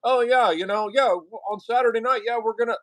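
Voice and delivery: high, breezy phone chatter; guy yapping on the phone. Retold or imitated. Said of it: "talking the whole time to somebody on the".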